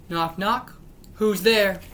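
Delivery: usual speaking voice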